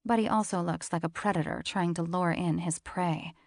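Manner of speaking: normal talking voice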